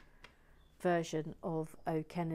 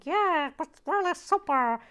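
loud, high squawking chicken voice; botched accent turned chicken, shouted high; Boston Nancy; 9 semitones higher, 9 dB louder than usual. Acted. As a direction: cluck and squawk the words like a hen. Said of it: "it appears I'm a chicken".

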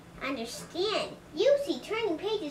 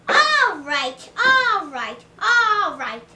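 loud, punched repeated outburst; exasperated; 3 semitones higher, 10 dB louder, slower than usual. Acted. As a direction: burst out, fed up, louder than talk. Grover exasperated by turned page.

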